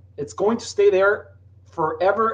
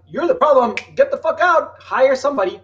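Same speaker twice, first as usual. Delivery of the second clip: raised, louder mock rant at the owner; blunt angry telling-off; 5 semitones higher, 5 dB louder than usual. Consultant tells the business owner to leave, mock angry rant. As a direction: let the owner have it, pitch up. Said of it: "Not as I would, like".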